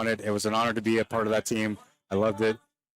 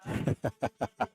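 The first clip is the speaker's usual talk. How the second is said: short, soft run of chuckles; quiet, staccato laugh; 5 dB quieter than usual. Real, not acted. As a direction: chuckle lightly at your own remark. Chuckles at own disclaimer.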